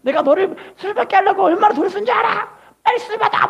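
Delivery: mother scolding daughter for cancelling surgery, hammed-up; angry mother. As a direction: imitate a mother yelling at her child.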